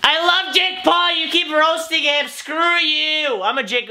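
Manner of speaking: high, slow whiny fan voice; mock Jake Paul fan.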